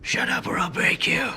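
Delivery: whispered menacing threat; gruff Batman growl. Pretend. Answering the interviewer as Batman, whispered menace. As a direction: growl it low like a caped vigilante.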